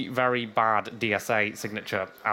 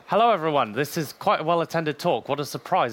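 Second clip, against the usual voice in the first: ordinary delivery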